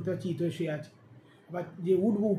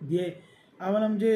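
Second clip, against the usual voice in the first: ordinary delivery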